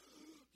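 sharp high staged gasp; hammed-up gasp of surprise; mock gasp. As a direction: gasp in fake shock. Mock shocked congregation.

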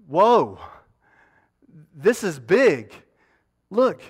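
a healed man can't believe it; excited amazement. Acted. Healed Naaman marvels at his own skin, loud and amazed.

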